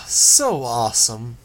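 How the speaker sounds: slow, drawn-out mock awe; sarcastic. A narrator's reading. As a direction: drip sarcasm slowly.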